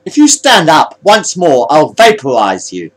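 slow, deliberate threat; warning. A narrator's reading. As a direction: start high and loud, then drop for the threat. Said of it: "he warned".

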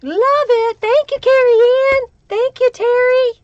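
gushing thanks to the donors; excited thanks. Real, not acted. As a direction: beam at the donors.

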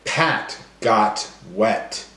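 slow, stretched words; slow sound-spotting sentence. Shown as a demonstration. Teacher modelling slowly for young learners. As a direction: draw out each word to show the end sound. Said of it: "every word's gonna end with the same sound".

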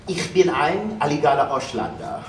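trying out a German accent; Canadian testing his German accent. Shown as a demonstration. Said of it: "German accent".

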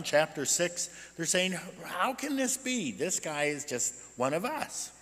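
usual speaking voice

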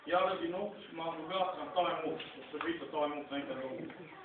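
ordinary talk

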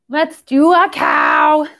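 usual speaking voice

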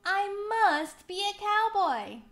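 high, loud, thrilled declaration; delighted cry. A narrator's reading. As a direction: announce a joyful realization. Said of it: "cried McCoy".

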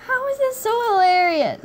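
ordinary talk

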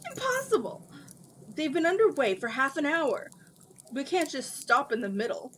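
loud, high, indignant protest; indignant outburst. Acted. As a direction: burst out in disbelief. Dragon protests interruption indignantly.

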